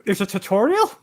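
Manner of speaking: high, loud incredulous question; surprised question. Real, not acted. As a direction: ask it as if totally caught out. Jokes they missed the tutorial.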